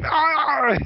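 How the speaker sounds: high excited yelp; hyper yell. Retold or imitated. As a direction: let out a wired, overcaffeinated yelp. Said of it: "it's like hyper".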